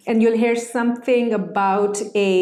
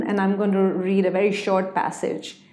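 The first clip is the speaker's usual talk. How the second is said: ordinary talk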